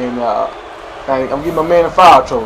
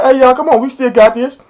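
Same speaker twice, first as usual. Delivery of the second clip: rallying teammates; 6 semitones higher than usual. Retold or imitated.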